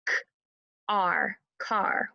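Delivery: K, R, car tapped out sound by sound; slow sound-by-sound tapping of car; segmented sounds. Shown as a demonstration. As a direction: break car into its sounds, then blend. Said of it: "So let's try it".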